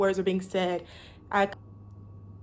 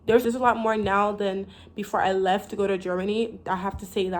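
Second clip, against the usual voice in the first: ordinary delivery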